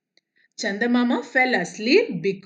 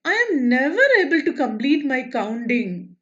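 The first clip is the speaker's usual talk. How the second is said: high, sobbing cry of the moon; 4 semitones higher, much slower than usual. Acted. Moon crying because he can never finish counting. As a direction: wail it out like a crying child. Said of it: "He began to cry".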